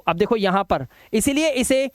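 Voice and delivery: ordinary talk, nothing marked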